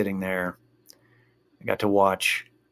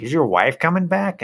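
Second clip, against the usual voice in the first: louder, higher Chicago guy; nosy fan; 7 semitones higher, 6 dB louder than usual. Retold or imitated. Fan behind him teasing about his wife, loud and higher. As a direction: needle him like a rowdy fan.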